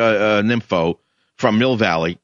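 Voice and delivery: normal talking voice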